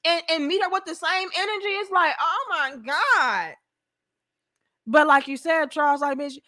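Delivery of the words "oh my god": drawn-out, higher mock shock; mock shocked woman. Woman reacting once he engages, mock shocked. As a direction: imitate feigned horror, very slowly.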